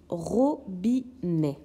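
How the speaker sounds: "robinet" slowly, three detached syllables with short gaps; découpage syllabique. Shown as a demonstration. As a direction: separate the syllables of "robinet" slightly. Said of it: "Donc je dessine". (« robinet » lentement, syllabes détachées)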